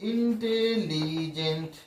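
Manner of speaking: Intelligent slowly, syllable by syllable; slow syllable breakdown. Shown as a demonstration. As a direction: stretch out each beat of intelligent. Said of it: "আস্তে আস্তে উচ্চারণ করে". (Intelligent ধীরে ধীরে ভেঙে)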